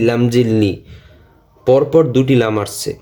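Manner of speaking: ordinary talk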